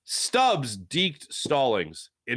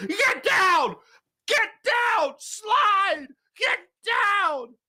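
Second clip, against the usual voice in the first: high, full shout like a teammate on deck; yelling; much slower than usual. Acted. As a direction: yell urgent slide orders at a baserunner. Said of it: "I just yelled at the podcast voice".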